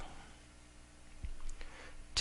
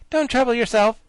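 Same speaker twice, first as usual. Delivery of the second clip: high, loud breezy dismissal; cheerful; 12 semitones higher, 9 dB louder than usual. Acted. Ginger answers the soldier, high cheerful dismissal. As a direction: brightly brush him off. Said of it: "said Ginger cheerfully".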